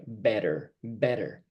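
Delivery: better with the tt flapped like a quick d. Shown as a demonstration. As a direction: flap the tt in better. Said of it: "like the double T sound".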